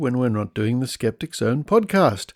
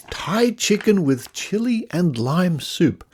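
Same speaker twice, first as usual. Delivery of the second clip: higher, slow and relishing every word; slow, higher mock-gourmet relish; savouring the name; 7 semitones higher than usual. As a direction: read it like a tasty treat. Rereads packet name with relish.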